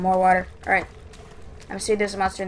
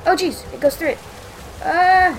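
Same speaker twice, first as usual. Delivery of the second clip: scared horror game streamer; 9 semitones higher, 7 dB louder than usual. Real, not acted. Monster right behind him.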